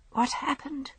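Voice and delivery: a weak patient just waking in hospital. A narrator's reading. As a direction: ask as if barely awake. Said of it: "she said weakly".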